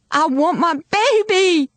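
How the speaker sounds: high, stretched-out yell; anguished shout. A narrator's reading. Girl shouting at policeman for her baby, anguished. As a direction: wail the demand, last word held. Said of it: "I shouted".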